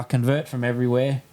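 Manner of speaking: ordinary delivery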